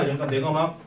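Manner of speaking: ordinary talk, nothing marked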